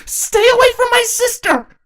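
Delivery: put-on very high, loud child's scream; a small child screaming in defiance. Little sister screams at the ponies to protect her sister. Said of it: "Quelula screamed at the ponies".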